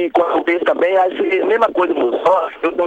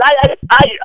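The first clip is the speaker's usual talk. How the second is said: louder, higher mock "ai, ai, ai" wail; imitating pained cries; 7 semitones higher, 8 dB louder, slower than usual. Shooter imitating the victim on the ground, mock wails. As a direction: act out the fallen man crying out. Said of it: "Aí ele".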